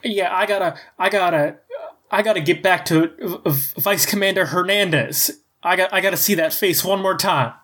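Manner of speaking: high, fast, laughing eagerness; triumphant. Acted. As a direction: jump up and declare it triumphantly. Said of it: "stands up very triumphantly, and goes".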